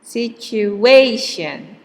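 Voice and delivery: Situation said slowly with the A syllable drawn out; slow stress demo. Shown as a demonstration. Teacher to class, slow careful Situation. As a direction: stretch Situation and lean on the A.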